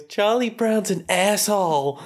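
put-on high, slow little-kid voice; a small boy being rude, played high; cheeky kid voice. Son tells dad Charlie Brown is rude, high kid voice.

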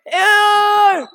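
loud, high disgusted "ew"; disgusted shout. Real, not acted. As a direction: yell it in disgust. Disgusted at being mistaken.

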